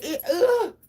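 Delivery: high, loud disgusted groan with a laugh; disgusted ugh. Real, not acted. A mother finding soaked laundry, loud disgusted ugh. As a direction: recoil at the smell.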